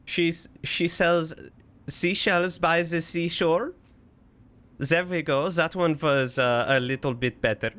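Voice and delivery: muddled put-on German accent; mock-German, v for w and z for th; weird German amalgamation. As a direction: fumble along in a vague German accent. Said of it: "Vaguely German is what I use".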